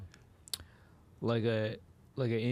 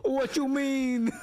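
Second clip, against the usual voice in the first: put-on voice; 13 semitones higher, 4 dB louder, much slower than usual. Mock voice in the bit.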